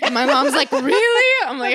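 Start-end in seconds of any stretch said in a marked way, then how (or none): 0.7-1.3 louder-pitched, questioning "really?" as mom; mock surprise. Mom hears the name dropping, mock surprised. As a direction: imitate mom's high, rising disbelief.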